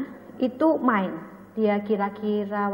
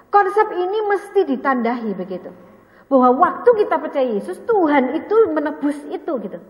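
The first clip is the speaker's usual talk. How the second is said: emphatic preaching; 4 semitones higher, 7 dB louder than usual. Real, not acted. Preacher stressing key concept.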